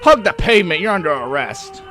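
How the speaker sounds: loud, high arrest order; megaphone yelling. A narrator's reading. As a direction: bellow it at a suspect. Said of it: "She yells into the microphone".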